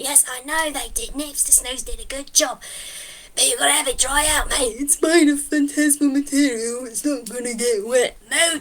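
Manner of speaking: critters squabbling over a hat; matey, exasperated banter; lightly voiced squabbling critters; bickering creature voices.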